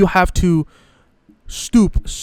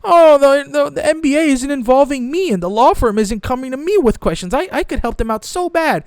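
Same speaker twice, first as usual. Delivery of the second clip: higher, whiny mock complaint; mock whining reporter; 7 semitones higher than usual. Mocking Pablo's whining on Twitter, higher and whiny. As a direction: whine like a left-out reporter begging for attention. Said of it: "starts whining".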